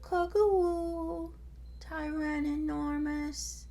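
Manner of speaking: put-on high, slow cooing baby voice. Terry greets her brother again, high slow coo. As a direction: coo it like a tiny sister. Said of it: "cooed Terry".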